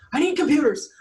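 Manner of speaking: high, frantic plea; imitating a stressed man begging for computers; freaking out. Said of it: "he's, like, freaking out".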